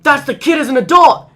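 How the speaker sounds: loud, high shocked exclamation; surprised. Retold or imitated. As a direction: blurt out a sudden discovery. Retold shocked realization.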